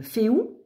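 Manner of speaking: féou said as fé-ou, two vowels, rising at the end; proto-Germanic pronunciation. Shown as a demonstration. Reading the proto-Germanic rune name aloud to viewers, féou said fé-ou with a rise. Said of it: "Mais en proto-germanique".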